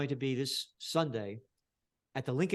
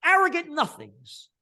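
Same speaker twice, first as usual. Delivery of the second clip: high, loud yell of contempt; outraged scream; furious shout; 14 semitones higher, 10 dB louder than usual. Real, not acted. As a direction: bellow the insult. Ranting at politicians.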